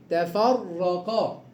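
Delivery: تفرقا drawn out slowly with a thick ra; slow heavy ra. Shown as a demonstration. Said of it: "إذا كانت الراء مفتوحة تفخم".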